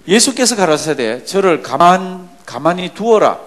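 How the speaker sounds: loud, slow, measured Bible recitation; scripture reading aloud. Acted. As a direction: lead the congregation through the verse, strong and drawn out. Reads verse aloud. (크고 느리게 또박또박 성경 봉독)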